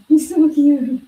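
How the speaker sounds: high loud squeal of cuteness; gushing. Real, not acted. Owner squealing over her chunky cat.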